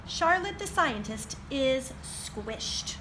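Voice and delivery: slow, savoured title reading; title read slowly. A narrator's reading. Librarian to children, the book title read slowly. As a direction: announce the title slowly to the kids.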